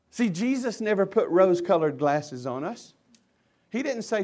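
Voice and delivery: ordinary talk, nothing marked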